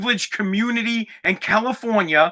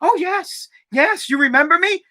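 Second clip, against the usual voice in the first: higher, flattered "oh, yes"; mock delight; 7 semitones higher than usual. Mocking an actress who thinks the dispatcher noticed her, mock delight. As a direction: imitate someone thrilled to be recognized. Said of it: "then she gets all happy".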